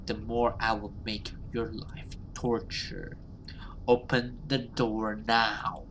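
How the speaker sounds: a demon promising torture through a door; cold threatening creature voice; menacing demon. Acted.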